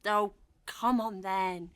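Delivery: normal talking voice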